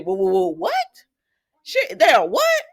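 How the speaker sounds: mimicking Liam's over-the-top shocked reaction; dramatic shock. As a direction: ham up the stunned double take. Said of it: "he's just so dramatic".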